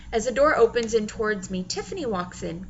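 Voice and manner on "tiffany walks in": plain reading voice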